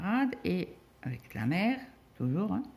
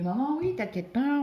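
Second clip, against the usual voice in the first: higher, louder reassurance; imitating son; 9 semitones higher, 4 dB louder than usual. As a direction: toss it off high like a teen. Fils rassure sa mère. (imitant son fils, plus aigu)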